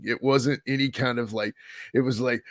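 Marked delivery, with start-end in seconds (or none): none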